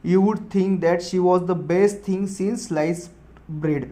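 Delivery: slow, emphatic reading; slow dictation. Shown as a demonstration. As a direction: dictate word by word. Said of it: "second भी sentence देख लो".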